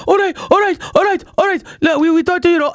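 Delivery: high, placating all right all right; calm her down in a hurry; imitating the flustered vendor. Vendor backing down, a high flustered placating voice. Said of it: "he's like".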